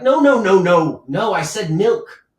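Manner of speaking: loud, high outraged refusal; angry lord. Acted. Lord scolding the servant over the wine, outraged shout. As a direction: bellow it like a furious noble.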